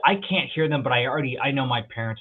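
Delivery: ordinary talk, nothing marked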